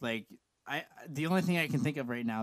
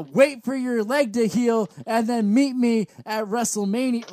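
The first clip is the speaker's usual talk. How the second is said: loud, high wrestling promo voice; wrestler promo voice; 5 semitones higher, 7 dB louder than usual. Shown as a demonstration. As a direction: bark it like a pro wrestler. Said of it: "doing it like that".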